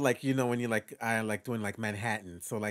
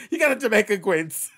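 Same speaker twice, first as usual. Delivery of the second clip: loud, high Jamaican Queens shout-out; Jamaican Queens accent; 8 semitones higher, 9 dB louder than usual. Shown as a demonstration. Performer touring borough accents, exuberant Jamaican Queens.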